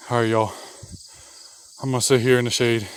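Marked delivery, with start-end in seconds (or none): 0.1-0.5 breathless, sighing; winded whisper; out of breath. Real, not acted. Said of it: "catch my breath".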